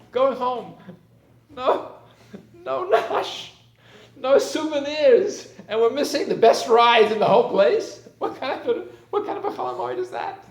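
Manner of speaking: put-on high, loud childish wail with a laugh; wailing kids. Kids to parents leaving the park, high loud wailing. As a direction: protest like a child robbed of the best rides. Kids lamenting going home.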